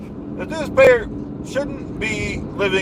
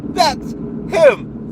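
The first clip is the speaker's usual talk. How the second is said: blustering rally-speech voice, big falling endings; pompous politician rant; 2 semitones higher than usual. Acted. Comic ranting at the audience about the dues payer, blustering politician voice. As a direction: rant like a self-important demagogue at a rally.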